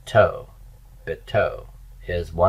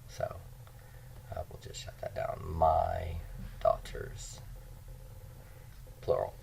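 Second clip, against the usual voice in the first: whisper; 3 semitones lower, 17 dB quieter than usual. Real, not acted. Aside while writing on board.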